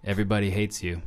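quiet, low deadpan imitation; retold insult. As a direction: drop it softly, low and matter-of-fact. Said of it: "and he goes".

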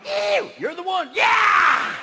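slow, then a high shouted yeah; a woman squealing for her cowboy; excited woman. Acted. Woman cheering the drunk rider, high excited shout.

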